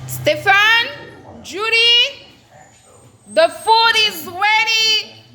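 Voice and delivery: yelling across the room. Real, not acted. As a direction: call the family from far away. Calling family to dinner.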